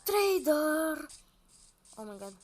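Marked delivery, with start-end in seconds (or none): 0.0-1.1 loud, high, drawn-out call; excited shout. Real, not acted. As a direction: holler it out on spotting him. Spots trader, calls out. (strigăt puternic și prelung)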